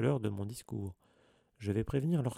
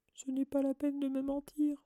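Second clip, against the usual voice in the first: very high, squeaky tearful voice; a hammed-up wail of hurt; sobbing Mimi. A narrator's reading. Ghost Mimi rejects the children's lie, tearful. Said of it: "sanglota Mimi".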